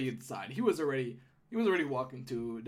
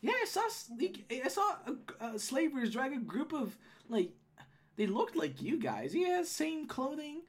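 hint of a high gnome-woman voice; Holly, recounting; 9 semitones higher than usual. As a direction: hesitantly describe what you saw, pitch swooping.

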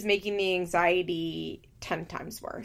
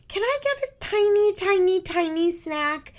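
put-on little kid voice; 11 semitones higher, 3 dB louder than usual. Imitating a nine-year-old daughter asking mom for a snack, in a high little-kid voice. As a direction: beg for the snack like a small child. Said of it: "in such a little kid way".

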